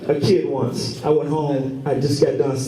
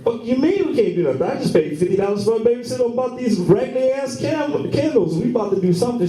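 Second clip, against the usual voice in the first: pitched-up incredulous protest; husband refusing to take no; indignant outburst; 7 semitones higher than usual. Retold or imitated. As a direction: push back high and outraged. Outraged husband retelling argument.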